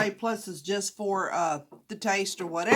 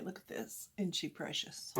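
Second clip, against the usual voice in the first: quiet; 3 semitones lower, 15 dB quieter than usual. Real, not acted. Showing earrings quietly.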